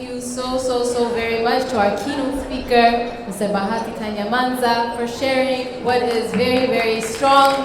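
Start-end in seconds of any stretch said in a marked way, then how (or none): none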